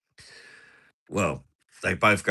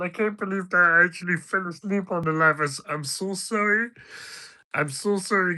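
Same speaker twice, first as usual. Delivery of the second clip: much higher mock voice of an apologetic mate; hammed-up sorry friend, pitched up; Patter getting sensitive; 10 semitones higher than usual. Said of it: "Now I'll do Patter getting sensitive".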